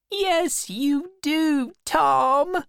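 high, hurt insistence; crying girl. A narrator's reading. Becky refusing to believe Tom, crying high voice. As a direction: insist in a high, tearful wail. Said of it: "She cried and she cried".

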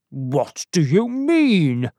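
slow, indignant question; mildly angry, drawn-out challenge; angry reply. A narrator's reading. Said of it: "replied Carswell angrily".

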